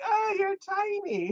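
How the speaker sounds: very high, slow cooing; cooing over a child. Retold or imitated. The boss cooing at the tiny girl, very high and slow. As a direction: gush over something tiny, slowly.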